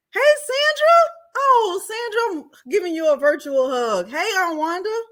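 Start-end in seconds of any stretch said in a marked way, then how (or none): 0.1-2.4 high, loud, delighted hello to Sandra; excited greeting. Real, not acted. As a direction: squeal her name with joy, stretching it out. Greeting a favourite viewer warmly.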